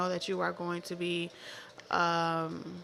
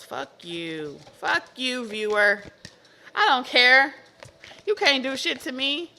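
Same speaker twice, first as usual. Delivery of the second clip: mock defiant yell; 4 semitones higher, 6 dB louder than usual. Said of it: "that's how they were talking".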